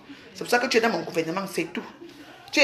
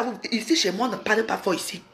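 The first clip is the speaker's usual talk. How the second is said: ordinary delivery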